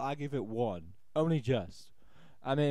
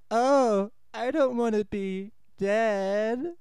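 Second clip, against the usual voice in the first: mock dying whine; 6 semitones higher, 6 dB louder, much slower than usual. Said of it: "It's like".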